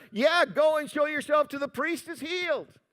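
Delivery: mock shout through cupped hands; imitated yell. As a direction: call back across a distance, half-yelled. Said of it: "and he yelled out".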